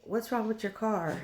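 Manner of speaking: ordinary talk, nothing marked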